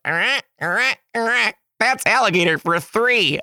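high croaky eh counting out three; baby alligator count. Retold or imitated. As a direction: chirp like a little gator, then explain. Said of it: "baby alligator noise".

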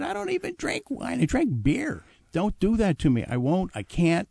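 high, panicky plea to get out of it; pleading protest. Retold or imitated. Young waiter begging the maitre d' to spare him, panicky pleading. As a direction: beg like a trapped waiter, voice jumping up. Begging boss not to assign job.

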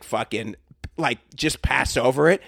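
ordinary talk

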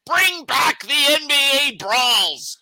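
hammed-up call to bring back brawls; mock rallying shout. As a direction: bellow it like a protest chant. Hosts demanding brawls return, shouting.

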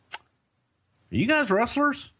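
cop asking two wrestlers, high-pitched question. Retold or imitated. Narrator quoting the cop at the car window, questioning cop. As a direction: put on a curious cop's high voice.

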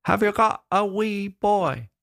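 put-on the girl asks again, slowly, high; little girl repeating. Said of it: "little girl came up".

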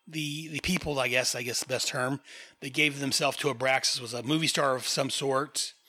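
ordinary delivery

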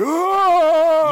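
a sudden, very high, loud roar; shrieking Warrior outburst; mock rage yell. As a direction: explode like an angry wrestler. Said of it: "And he's like".

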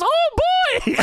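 Elmo's high, squeaky cartoon exclamation; high-pitched Elmo. Elmo walks into the room, high squeaky cartoon voice. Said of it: "there's only so much high pitch I can do here".

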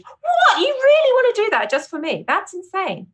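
high, squealing disbelief; mock astonished. Guest acting out her reaction to a fan page, high and amazed. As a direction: gasp it out way up high, flattered. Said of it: "if someone makes, like, a fan page".

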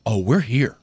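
lightly imitated friend, small and low; a hint of a meek, low mimicry; little voice. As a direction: shrink down to a soft little voice. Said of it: "With that little voice".

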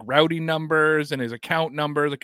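ordinary delivery

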